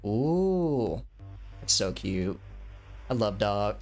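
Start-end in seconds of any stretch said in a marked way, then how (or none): none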